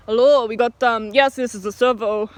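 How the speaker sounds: lightly attempted Indian accent on the phone; imitated manager's Indian accent; terrible Indian accent. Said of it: "terrible Indian accent".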